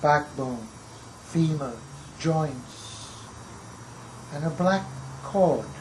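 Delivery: measured recital, phrase by phrase with long pauses; poetry reading. Acted. Poet reciting to an audience about skulls of a lost tribe, measured poetry reading. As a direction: recite it gravely, weighting single words and letting lines fall. Reads second poem aloud.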